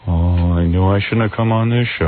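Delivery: low, droning mock guest voice; mock a miserable guest in a low drone; George Clooney. Imitated guest regretting coming on this show, low glum drone. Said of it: "this is how he talks".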